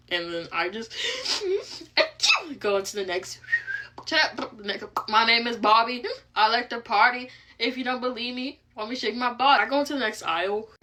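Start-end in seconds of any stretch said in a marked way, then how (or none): 1.0-2.5 squealing laugh, high up; high burst of laughter. Real, not acted. 4.0-9.6 tic outburst. Real, not acted. Tics while shopping.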